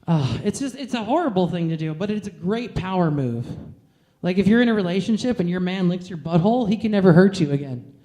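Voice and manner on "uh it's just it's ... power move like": ordinary delivery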